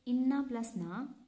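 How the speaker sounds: normal talking voice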